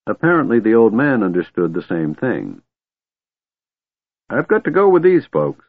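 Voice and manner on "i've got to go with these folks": put-on very high-pitched old man's voice. A narrator's reading. Old man tells dragon he must go, insistent. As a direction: explain insistently, pitched right up. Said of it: "he said to the dragon".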